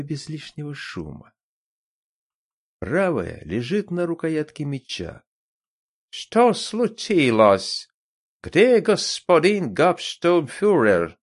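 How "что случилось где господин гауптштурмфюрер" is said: louder, slow, wary German guard. A narrator's reading. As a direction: call out slow and suspicious. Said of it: "Настороженный голос".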